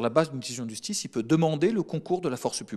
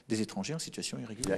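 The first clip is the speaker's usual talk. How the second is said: whispered aside; 3 semitones lower, 8 dB quieter, faster than usual. Real, not acted. Minister lowers voice on sensitive point.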